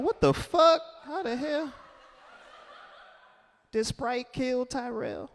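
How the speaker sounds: high, baffled, then fading to laughter. Retold or imitated. Reacting to a sponsored memorial, baffled and laughing. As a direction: gasp it out in disbelief.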